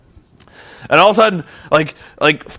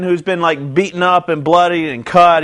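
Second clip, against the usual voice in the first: ordinary delivery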